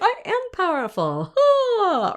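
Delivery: high, grand mock power voice; mock powerful singer. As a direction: belt it out like a diva. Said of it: "You're like".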